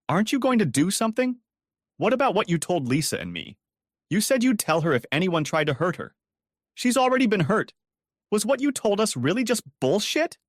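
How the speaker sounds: screaming. A narrator's reading. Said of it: "she screamed".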